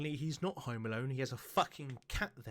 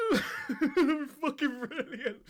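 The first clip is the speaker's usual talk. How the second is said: slow, much higher, loud through laughter; laughing; 9 dB louder, much slower than usual. Real, not acted. Laughing at his own toilet ghost bit, drawn out and loud. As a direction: squeeze it out mid-laugh.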